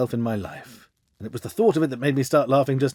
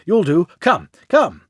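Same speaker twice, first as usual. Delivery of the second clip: urgent summons; 4 semitones higher, 6 dB louder, much slower than usual. A narrator's reading. Groom urging Holmes, rushing.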